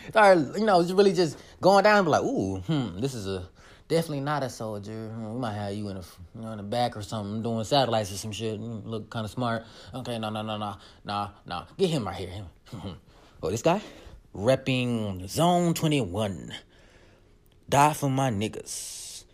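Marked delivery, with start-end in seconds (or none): 2.2-13.8 low, quiet muttering to oneself; murmured, mock-deliberating aside; musing recruiter. Acting out recruiters reviewing someone's statuses. 14.3-19.2 slow, low, drawn-out read; low, lingering recital of someone's post; reading a status. Acted. Recruiter slowly reading out a status.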